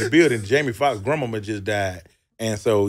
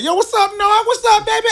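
much higher, loud mock-cheerful hail; fake friendly greeting; 5 dB louder than usual. Greeting his arch enemy Nard, hammed-up high cheerful hail. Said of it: "I was like".

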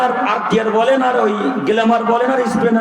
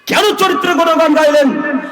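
higher and louder, demanding an answer; loud challenge; 6 semitones higher, 3 dB louder than usual. Real, not acted. Preacher confronting the villagers, a loud challenge. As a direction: hurl the question at the crowd.

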